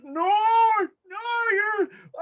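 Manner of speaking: high, strained cries of protest; panicked protest. Acted. Game master voices Hollister grabbed with mouth covered, panicked protest.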